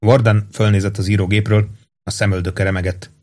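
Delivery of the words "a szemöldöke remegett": ordinary delivery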